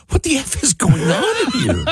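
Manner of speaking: high-pitched, laughing disbelief; mock incredulous. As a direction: act out baffled outrage through laughter. Acts out own incredulous reaction.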